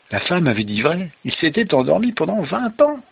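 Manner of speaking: high, rising storytelling revelation; amazed narration. Acted. Father retelling the revelation to child, amazed narration. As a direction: lift the voice in astonishment.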